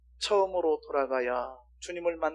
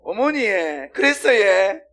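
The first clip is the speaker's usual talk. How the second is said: imitating Gyeongsang dialect, louder and higher; 5 semitones higher, 8 dB louder, slower than usual. Friend on the phone switching to Gyeongsang dialect. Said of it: "어느 날 전화하는데".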